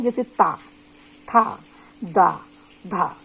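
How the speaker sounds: ता था दा धा with the tongue on the upper teeth, each set apart; teacher's dental drill; dental sounds demo. Shown as a demonstration. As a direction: model each dental syllable in turn. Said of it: "इसके कौन कौन शब्द होते हैं".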